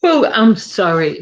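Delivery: mid-yawn apology. Real, not acted. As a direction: talk through a yawn. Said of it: "Excuse me for yawning".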